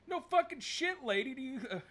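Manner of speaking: loud, high, exasperated shout breaking into laughs; exasperated yell. Retold or imitated. Hero yelling at the dispatcher over the phone, exasperated and laughing. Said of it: "He's like".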